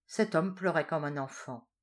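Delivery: ordinary delivery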